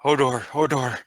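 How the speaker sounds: lightly voiced simple giant repeating his name; a hint of Hodor; Hodor impression. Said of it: "like the Game of Thrones shame".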